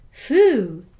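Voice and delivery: loud blowing phoo sound; dog's loud phoo. Acted. Dog skeleton reacting to being mixed up, loud phoo. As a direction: puff it out like an offended dog. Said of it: "said the dog skeleton".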